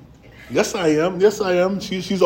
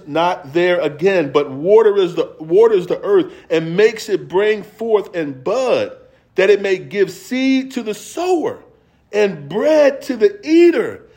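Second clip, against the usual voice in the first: rising preacher's cadence, climbing higher toward the end; preaching; 6 semitones higher, 4 dB louder, slower than usual. Acted. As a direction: build the verse up to its peak. Continues preaching the verse.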